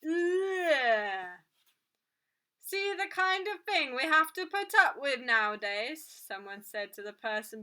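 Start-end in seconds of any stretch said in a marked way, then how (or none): 0.0-1.4 loud, drawn-out "ugh" of disgust; high, falling noise of revulsion; disgusted groan. A narrator's reading. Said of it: "eyed Cassiopeia with disgust". 2.6-6.0 loud, higher grumble of disgust; raised, disapproving grown-up's voice. A narrator's reading. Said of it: "eyed Cassiopeia with disgust".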